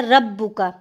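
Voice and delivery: रब्बुका with the ra heavy and full; correct recitation. Shown as a demonstration. Teacher models the heavy ra of रब्बुका. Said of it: "ऐसे पढ़ते हैं ना".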